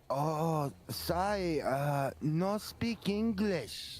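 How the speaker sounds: high, slow broken English; mock broken English. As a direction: play the tourist who speaks no English. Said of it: "but they'll go".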